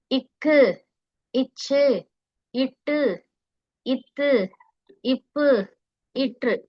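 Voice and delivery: இக்கு, இச்சு with the doubled consonant pressed hard. Shown as a demonstration. Teacher demonstrating vallinam, hard pressed consonants. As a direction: stop firmly on the consonant in இட்டு, then release. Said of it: "கொஞ்சம் அழுத்தி சொல்றது".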